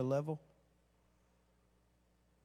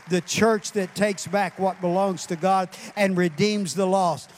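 loud, rising preacher's cry; preaching at full force; 2 semitones higher, 6 dB louder than usual. Acted. Preacher rallying the congregation, loud building preaching. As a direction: build the crowd up, louder and louder.

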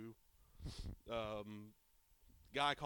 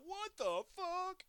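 slow, much higher, drawn-out yell; imitated offscreen yell; 3 dB louder, much slower than usual. Podcaster quoting the offscreen guy, mock yell. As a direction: stretch it out like a shocked bystander. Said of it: "you hear him off screen".

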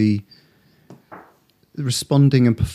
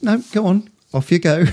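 mock breezy physio; 8 semitones higher than usual. Interviewer mimics physio, laughing.

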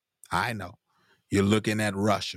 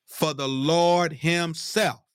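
preached verse; 8 semitones higher, much slower than usual. Shown as a demonstration. Preacher rereads the verse to the church, preaching it out. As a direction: draw out each word like a climax. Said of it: "Look at this".

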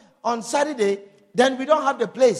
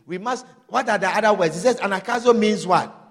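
usual speaking voice

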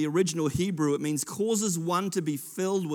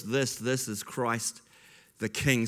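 slow, softer, reverent recitation; hushed reverent chorus; reciting the chorus; 6 semitones lower, 3 dB quieter, slower than usual. Acted. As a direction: speak it low and slow like a hymn.

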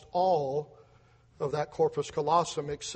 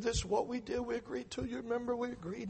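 put-on higher, softer Lebanese-accented voice; 5 semitones higher, 6 dB quieter than usual. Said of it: "And he talks like that".

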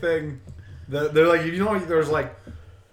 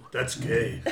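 lightly voiced low robot deadpan; a hint of movie cyborg; Terminator; 6 semitones lower, 7 dB quieter than usual. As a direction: drop it low and soft. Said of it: "His best Terminator voice".

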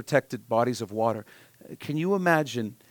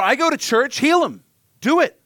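much higher, louder bossy command; entitled demand; 12 semitones higher, 8 dB louder than usual. Retold or imitated. Churchgoer demanding God heal the boy, high loud insistence. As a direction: order God around, impatient.

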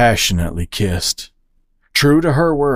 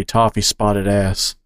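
ordinary delivery, nothing marked

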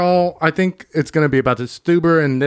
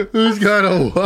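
laughing quote; 3 semitones higher than usual. Retold or imitated. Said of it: "I love that line".

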